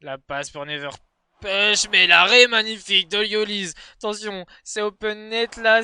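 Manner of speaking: energetic live play-by-play; esports caster. Acted. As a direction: call the match live. Live Rocket League match commentary.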